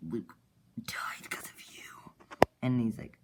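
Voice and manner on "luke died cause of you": slow, voiceless whisper; whispered accusation. Retold or imitated. Bloodman accuses the scar guy over Luke, slow whisper. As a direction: whisper it slowly as an acted accusation.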